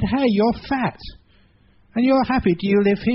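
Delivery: perking up surprised. Acted. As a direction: brighten up in surprise.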